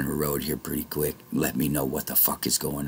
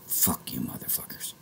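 whisper; 4 semitones lower, 11 dB quieter than usual. Retold or imitated. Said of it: "I just said".

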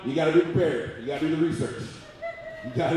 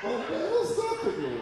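way higher, laughing, playfully doubtful; mock skeptical squeak; 5 dB quieter than usual. Comedian teasing front row about being ready, high laughing squeak.

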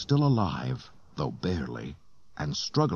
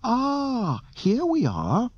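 very high, prim robot voice; fussy droid; much slower than usual. A narrator's reading. Droid arriving at the docked ship, high and fussy. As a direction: sing out high as a pleased droid.

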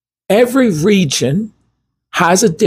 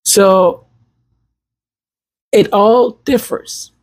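normal talking voice

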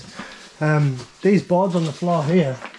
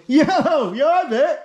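calling down from tower; 9 semitones higher than usual. Real, not acted. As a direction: shout a cheery greeting down. Calls down to friend below.

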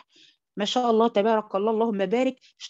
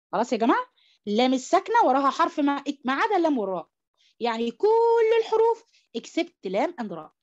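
ordinary talk, nothing marked

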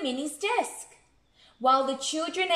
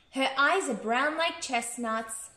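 ordinary delivery, nothing marked

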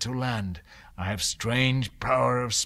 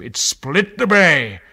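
much louder, higher burst; loud climax; 5 semitones higher, 13 dB louder than usual. A narrator's reading. Narrator booming as the sound splits the bay. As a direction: let the words crash like thunder.

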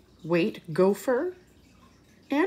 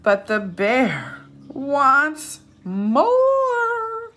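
slow, loud and drawn out; big refrain; 6 semitones higher, 6 dB louder, much slower than usual. Shown as a demonstration. Reading the refrain to children, slow and loud. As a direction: stretch the refrain out for the kids.

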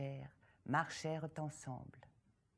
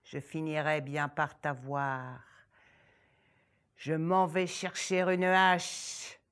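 grumbling ogress; 3 semitones lower, 7 dB louder than usual. Acted. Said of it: "L'horrible ogresse marmonna".

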